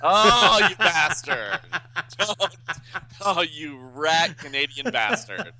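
high, loud laughing protest; laughing outrage. Real, not acted. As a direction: curse your friend through real laughter. Mock outrage at friend's prank.

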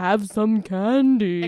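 slow, loud spooky monster voice; scary voice. Retold or imitated. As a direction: drag it out like a haunted toy. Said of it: "just in a scary voice".

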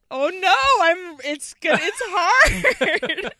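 high, loud and tearful with a laugh; choked up. Real, not acted. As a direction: fight back real tears. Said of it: "[name] is crying".

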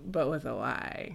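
low, soft seductive purr; sexy voice. Retold or imitated. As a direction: say it low and sultry. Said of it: "you have to use, like, a sexy voice".

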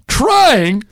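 very high, loud mock shouting rant as Lasorda. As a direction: scream it like a furious old manager. Lasorda ranting at Ashley.

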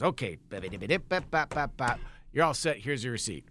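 low clerk voice tapping out bap bap; lightly imitated clerk typing noises; mock keyboard tapping. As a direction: mouth the key presses like a bored clerk. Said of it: "And he goes".

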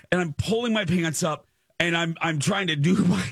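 high, anxious retelling; reliving anxiety. Retold or imitated. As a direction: tell it with the panic creeping back. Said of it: "I'm feeling the anxiety".

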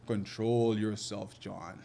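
slow, low, soothing coaching; soothing calm. Retold or imitated. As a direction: talk a nervous angler down slowly. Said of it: "I could see the panic from here".

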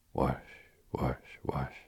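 whispered whooshing flame noises. Shown as a demonstration. Whispered flame whooshes acting out the burning deck. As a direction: breathe out a whoosh for the fire. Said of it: "The flames, you see".

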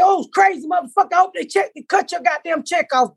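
high, indignant muttered-insult rant; mock cursing. Cursing out the crazy man, mock outrage. Retold cursing after the argument.

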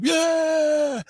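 high, whooping fan cheer; crowd cheer. Retold or imitated. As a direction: whoop it like a rowdy fan in the stands. Rowdy college kids cheering wrestlers.

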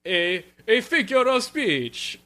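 put-on high, slow accented old mouse, a bit like the Count; a gullible father drawled out slowly; Count-like Papa voice. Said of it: "I don't know what I'm talking like".

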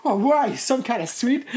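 high, loud incredulous question; mock the confused boyfriend; hammed-up bewildered shout; baffled Jeffrey. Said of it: "He's like".

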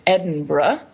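Edinburgh ending in a short bruh; British Edinburgh, last syllable bruh; burgh said as bruh. Shown as a demonstration. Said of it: "Borough endings are pronounced bruh".

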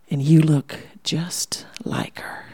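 slow, breathy close whisper; confiding whisper. Retold or imitated. Old man whispering to Julie that she looks like her mother. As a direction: lean in and tell her a secret.

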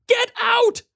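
terrified woman screaming at an intruder; young woman screaming. Acted. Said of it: "she screamed".

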